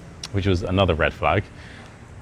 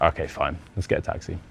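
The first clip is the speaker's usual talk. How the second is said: softer, lower, resigned "okay fine"; reluctant giving in; 3 semitones lower, 8 dB quieter than usual. Retold or imitated. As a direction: give in with a sigh. Reluctant agreement.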